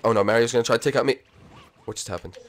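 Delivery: normal talking voice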